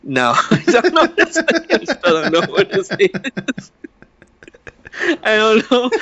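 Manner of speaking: high, laughing while answering. Real, not acted. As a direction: chuckle through the admission.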